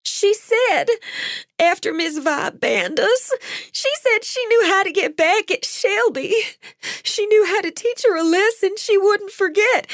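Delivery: high, tearful and shaky; blubbering confession. A narrator's reading. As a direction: spill it out while crying. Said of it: "Stop blubbering now".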